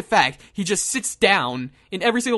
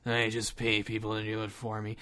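very low, soft gruff action star; quiet, gruff, barely intelligible tough guy; Steven Seagal; 11 semitones lower, 11 dB quieter than usual. Acted. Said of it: "He actually talks like that".

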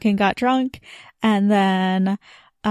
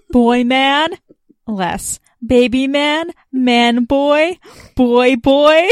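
guessing names; 4 semitones higher, 7 dB louder, much slower than usual. Retold or imitated. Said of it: "parody dialogue read aloud".

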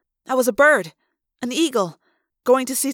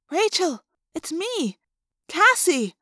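lightly voiced girl, higher and concerned; a hint of a gentle, worried friend; Cassie; 5 semitones higher, slower than usual. A narrator's reading. Girl reassuring her confused friend, lightly voiced. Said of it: "girl who called herself Cassie said".

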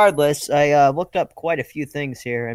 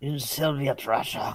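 put-on joking Russian accent, softer; mock Russian accent; 6 dB quieter than usual. Co-host teasing host about going Russian, mock Russian accent. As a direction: toss out a mock Russian line.

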